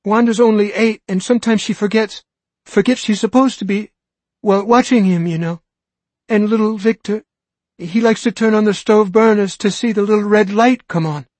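put-on a mother fearing for her small kids; anxious pleading. Frantic mother explains.

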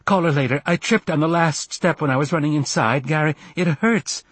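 high, complaining cry; distressed, insistent begging; pained plea. A narrator's reading. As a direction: plead through the pain, pitched high. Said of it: "she cried".

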